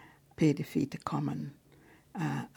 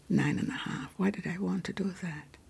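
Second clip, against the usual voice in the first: ordinary delivery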